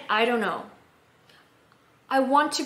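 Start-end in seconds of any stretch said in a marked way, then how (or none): 0.1-0.6 "I don't know" blurred into one run of sound; casual "I don't know". Shown as a demonstration. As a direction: slur "I don't know" together the way people really say it. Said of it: "我会念".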